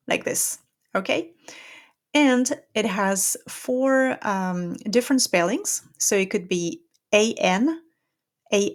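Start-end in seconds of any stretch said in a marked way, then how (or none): none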